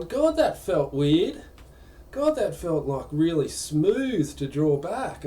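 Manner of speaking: high, slow puzzled wonder; drawn out, baffled at the draw; mild mock surprise at the smooth pull; puzzled exclaim. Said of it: "and I've gone".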